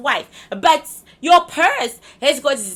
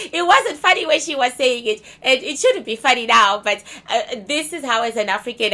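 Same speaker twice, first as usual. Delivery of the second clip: normal talking voice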